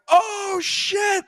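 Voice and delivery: high, stunned curse; shocked curse. Retold or imitated. Fan watching a wild game, high stunned curse. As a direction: blurt it out in shock. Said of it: "made me go like".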